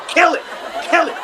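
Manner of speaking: high, urgent comic command; hammed-up panic over a mosquito; mock alarm. Acted reaction to imagined mosquito.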